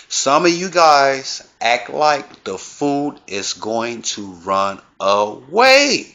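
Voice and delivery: slow, deliberate repeat. Shown as a demonstration. Repeating the point to greedy guests, slow and deliberate. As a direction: spell it out word by word. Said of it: "I repeat".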